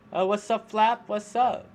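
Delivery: loud, high, happy hello; excited greeting. Real, not acted. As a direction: call out a cheery greeting. Greeting a chatter on stream.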